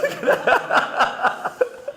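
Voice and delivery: a burst of high, airy laughter; cackling at the punchline; high breathy laugh. Real, not acted. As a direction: laugh out at the joke. Preacher laughs at own joke.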